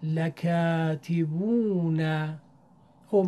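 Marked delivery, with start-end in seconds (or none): 0.0-2.0 لکاتبون chanted slowly with the long او held; slow recited example. Shown as a demonstration. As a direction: stretch the او in لکاتبون. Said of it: "چهارمین کلمه لکتبون،".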